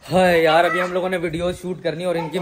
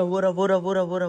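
ordinary talk

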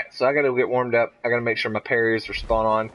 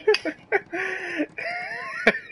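shrieking laugh; 3 dB quieter than usual. Real, not acted.

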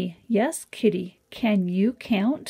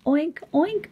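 mock pig oink; 6 semitones higher than usual. Reading animal sounds to children.

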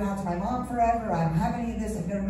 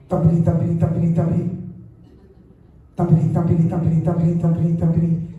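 lower, louder thumpity thumpity like a paw pounding the door; loud thumping imitation; 5 semitones lower, 7 dB louder, faster than usual. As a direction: pound out the thumps at full force.

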